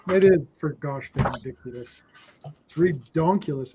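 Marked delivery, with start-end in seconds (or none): 2.5-3.7 "redonkulous" with a DONK in the middle; playful mangled word "redonkulous"; joke pronunciation. Pretend. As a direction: say "redonkulous" for ridiculous, stressing "donk". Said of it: "It's redonkulous is what it is".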